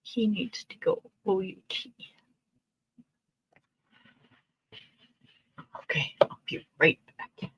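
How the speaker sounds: hushed whisper, spelling it out. Real, not acted. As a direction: whisper so he sleeps. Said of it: "husband's not waking up".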